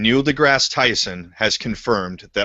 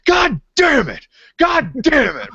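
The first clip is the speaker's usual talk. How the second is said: much higher, drawn-out mock yell of frustration; frustrated yelling; 4 dB louder, slower than usual. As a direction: howl it like you just blew the take. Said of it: "letting loose".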